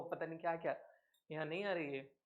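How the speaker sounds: ordinary talk, nothing marked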